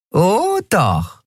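high-voiced gloating retort; gleefully mean, pitched high; mean grin. A narrator's reading. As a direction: relish the threat with a nasty grin. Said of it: "Nelen grinste fies".

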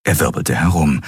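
ordinary delivery, nothing marked

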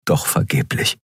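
ordinary delivery, nothing marked

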